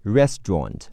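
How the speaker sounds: "restaurant" the British way, two syllables, "REST-ront"; British pronunciation. Shown as a demonstration. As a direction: say "restaurant" in British English, middle vowel dropped. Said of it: "英式发音是".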